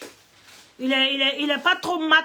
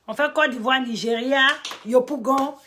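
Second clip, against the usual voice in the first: normal talking voice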